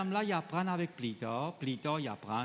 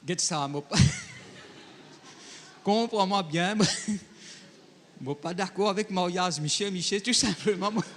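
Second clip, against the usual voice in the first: lightly voiced wife, higher and louder; mock wife; 5 semitones higher, 4 dB louder than usual. Preacher voicing a wife who rejects marriage, hammed-up. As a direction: act the wife refusing, laughing. Preacher acting a wife's reply.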